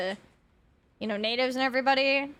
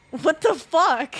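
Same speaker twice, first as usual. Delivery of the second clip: incredulous outburst; 4 semitones higher, 7 dB louder, slower than usual. Real, not acted. Reacting to chat's hive mind.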